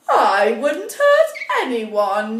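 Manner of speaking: slow, wounded queen's denial; queen protesting she's innocent. Acted. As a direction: plead innocence slowly, a touch theatrical. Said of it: "she said".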